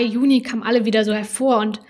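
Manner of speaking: ordinary delivery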